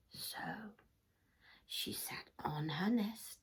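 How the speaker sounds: hushed whisper; whispering story. A narrator's reading. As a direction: whisper it to a little one.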